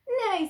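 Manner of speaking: high, loud imitated neigh; falling storybook whinny; horse neigh. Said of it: "said the horse".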